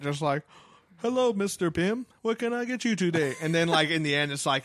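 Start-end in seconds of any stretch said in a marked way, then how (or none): 1.0-3.3 higher, chipper servant robot voice; polite butler robot. Retold or imitated. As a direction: play a friendly helpful robot servant. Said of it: "a friendly robot servant".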